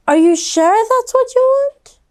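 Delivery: very high, incredulous mother voice; hammed-up unsure mom; doubtful mom. A narrator's reading. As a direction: ask her in disbelief. Mom in story.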